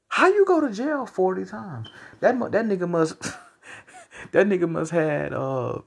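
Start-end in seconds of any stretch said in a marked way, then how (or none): 0.1-1.9 incredulous. Real, not acted. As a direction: stretch it out in disbelief. Disbelieving rant.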